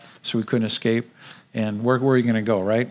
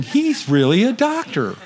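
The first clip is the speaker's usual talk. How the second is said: awed realization; 9 semitones higher than usual. Retold or imitated. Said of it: "they're all thinking".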